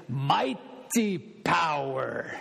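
put-on slow, drawn-out Argentine-accented "mighty power". Pastor imitating his preaching professor, Argentine accent. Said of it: "with that Argentine accent".

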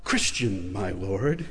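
meek reply. Retold or imitated. Believer quietly answers the Lord, meek and soft. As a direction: answer humbly, under your breath.

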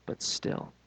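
whispered, hushed suspense. A narrator's reading. Building suspense for the church, whispered. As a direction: drop to a storyteller's whisper.

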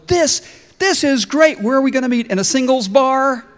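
frog can't wait to meet her; eager frog. Acted. Frog asking the fortune teller where, giddy and loud.

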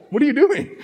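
high, half-laughing retake of the shout; mild startled cry. Shown as a demonstration. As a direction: redo it lighter, amused at yourself. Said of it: "I was just like".

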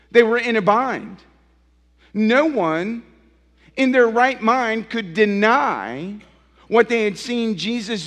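rising pulpit fervour; full-force preaching. Acted. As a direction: preach it out to the congregation.

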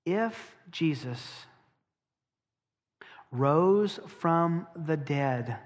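very slow, weighty delivery with long pauses; slow solemn preaching; slow emphasis. Real, not acted. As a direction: let each word land slowly. Preacher slows for key claim.

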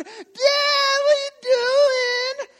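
slow, high, loud crying shriek; panicked kids. Retold or imitated. Kids fleeing dad with the logs, panicked wail. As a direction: wail it like a terrified child. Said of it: "and the kids are".

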